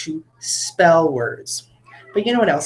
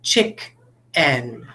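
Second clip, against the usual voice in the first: "chick-en" said in two clear beats, a gap between; syllables split apart; 5 semitones lower, 3 dB quieter than usual. Shown as a demonstration. Teacher showing the class the beats of "chick-en". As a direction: tap out each syllable of "chick-en". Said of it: "So, like".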